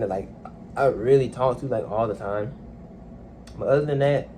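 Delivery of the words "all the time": ordinary delivery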